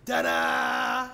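loud mocking ta-da; showman shout. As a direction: reveal the trick with a triumphant shout. Villain's magic trick reveal.

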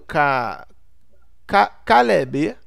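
"Caleb" stretched syllable by syllable, loud; halting reading. Acted. Learner sounding out a player's name, halting loud reading. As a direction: labour through "Ca-, Ca-, Caleb" like a new reader. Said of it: "seu estilo de leitura".

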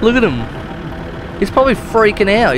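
ordinary delivery

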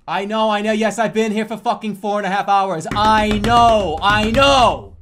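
loud, high exasperated yelling, building to a roar; grito exasperado. Pretend. Staged argument with chat.

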